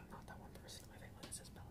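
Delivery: fast secretive whisper; whispering, a conspiratorial aside. Real, not acted. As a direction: hush it like a confession, quickly. Secretive aside about family member.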